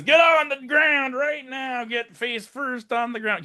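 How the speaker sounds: high, slow mock yell; retold sheriff's order. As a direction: act out a cop yelling commands. Retelling sheriff barking orders at suspects.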